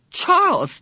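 high, loud frantic scream of a name. A narrator's reading. Meg screams at her brother, high and loud. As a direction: scream it in panic. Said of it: "Meg screamed".

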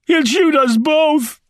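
very high, drawn-out panicked yell. A narrator's reading. Wounded Rice warning Shaw of the shooter, yelling. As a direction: stretch out a desperate high-pitched cry. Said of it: "yelled Rice".

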